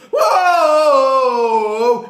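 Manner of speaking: high, loud whooping cry; loud joyful whoa. Real, not acted. Fan celebrating, loud high whoop. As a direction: let out a victory whoop.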